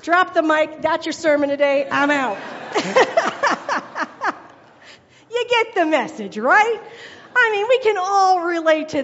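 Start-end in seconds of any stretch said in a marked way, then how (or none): 2.4-4.5 real high, loud burst of laughter. Real, not acted. Preacher laughing at her own joke, loud and high.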